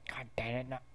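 ordinary talk, nothing marked

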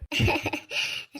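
short wordless laugh; light laugh. Acted. Woman amused by her friend cheering her up, laughing. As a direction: laugh at his remark. Laughs at his pleased remark.

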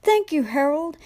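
high storybook thanks; whistling engine voice. A narrator's reading. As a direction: pipe it up like a little train. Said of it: "he whistled".

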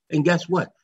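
normal talking voice

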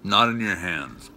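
talking while chewing; speaking through food; mouth full. Real, not acted. Seen 1: bites sandwich before; cheeks stay full, chewing between words.

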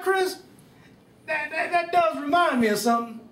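a mishearing grandpa getting all worked up; birthday man mishearing his wife and laughing; excited old man. Acted. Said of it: "to Jack Hadley".

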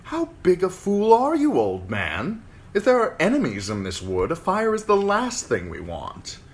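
put-on haughty, scornful young noble; arrogant young lord. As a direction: sneer it at the old man. Waymar scolds the old ranger.

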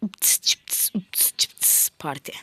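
whispered playful chant; sing-song whisper. Real, not acted. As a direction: breathe it out teasingly.

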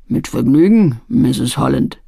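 rough man's voice. A narrator's reading. Mr Barry voiced.

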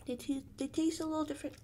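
speaking through fingers; muffled by hand; hand over mouth. Real, not acted. Seen 0: hand at lips 3.3–5.1 while speaking; chewing not clearly seen.

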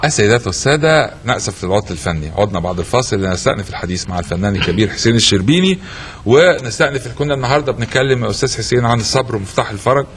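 low formal broadcast voice; announcer voice. Acted. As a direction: announce it like a TV host. Host announcer read.

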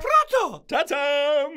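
ta-da, a vain critic arriving; grand entrance. Acted. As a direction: present yourself with a flourish.

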